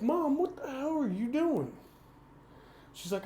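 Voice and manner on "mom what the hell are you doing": higher, outraged cry at mom; shocked outburst. Retold or imitated. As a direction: blurt it out, stunned and angry. Arriving at psychiatric hospital.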